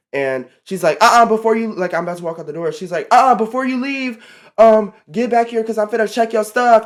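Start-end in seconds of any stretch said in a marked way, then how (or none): 1.0-1.7 loud, higher imitation of coworker; calling her back. Coworker stopping her at the door, imitated loud call. 3.1-6.8 loud, higher imitation of coworker; calling her back. Coworker stopping her at the door, imitated loud call.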